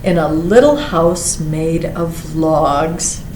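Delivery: usual speaking voice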